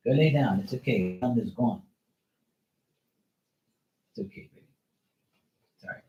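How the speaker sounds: soft reassuring coo to a scared dog; soothing pet. Real, not acted. Soothing dog after thunder.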